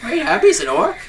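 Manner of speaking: high-pitched, baffled Irish character; character voice with Irish accent. Acted. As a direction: squeak the question in a bad Irish accent. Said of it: "that was in a character voice".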